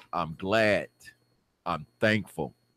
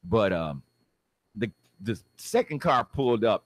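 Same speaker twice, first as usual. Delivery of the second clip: ordinary talk, nothing marked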